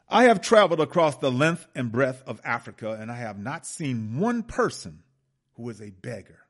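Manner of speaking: loud declaiming speech to parliament; dramatic oratory. Acted. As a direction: proclaim it like a grand speech. Reads Macaulay's address dramatically.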